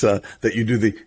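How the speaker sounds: normal talking voice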